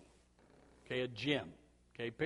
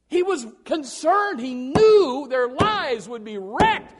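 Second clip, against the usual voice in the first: preaching; 8 semitones higher, 12 dB louder, slower than usual. Real, not acted. Preaches at full force.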